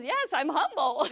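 loud, high retold retort; hammed-up proud protest; mock indignant insistence. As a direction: insist on it loudly, half laughing. Mimicking man's indignant insistence.

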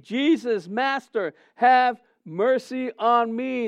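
half-shouted plea to someone far off; acted yell. Preacher imitating lepers seeking attention, mild yell. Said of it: "yell to the top of their lungs".

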